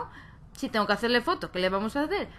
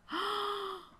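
gasp; 9 semitones higher, 9 dB quieter than usual. Real, not acted. Reacts to Sims dying.

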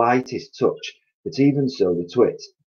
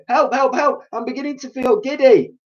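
very high, loud scream for help; terrified scream; 5 dB louder than usual. A narrator's reading. Missus Twit feeling giddy, very high terrified scream. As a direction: scream in terror. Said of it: "screamed Missus Twit".

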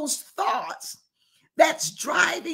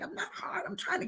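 soft breathy whisper of I'm not hard; whispered protest; hushed denial; 5 semitones higher, 11 dB quieter, faster than usual. Real, not acted. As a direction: drop to a whisper to insist. Denies being harsh, hushed.

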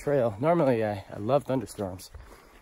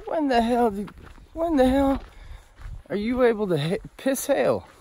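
amazed Zeus; 13 semitones higher than usual. Retold or imitated. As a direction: squeak it out in disbelief. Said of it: "Zeus steps back ... and goes".

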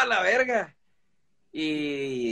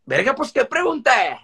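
higher and louder, mock exasperated retort; retold exasperation; 7 semitones higher, 5 dB louder than usual. Telling his wife off in the retold fight, mock exasperated. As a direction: snap back at her like she's being silly.

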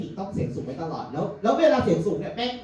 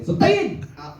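much higher shrill curse heard from the driver's seat; sudden high curse; 5 semitones higher than usual. Retold or imitated. Mother curses the driver beside, much higher shrill cry. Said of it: "ได้ยินเสียง".

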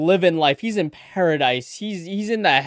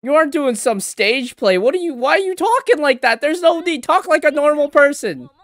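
exasperated; 7 semitones higher, 6 dB louder than usual. Real, not acted. Reacting to a character's dramatic speech.

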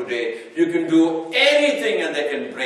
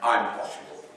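quiet retort; 7 semitones lower, 9 dB quieter than usual. Shown as a demonstration.

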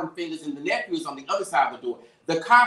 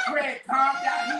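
laughing; 8 semitones higher, much slower than usual. Retold or imitated. Laughing while quoting herself.

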